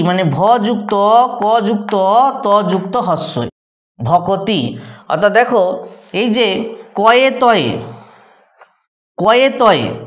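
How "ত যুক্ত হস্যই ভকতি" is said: normal talking voice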